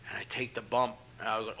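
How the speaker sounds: usual speaking voice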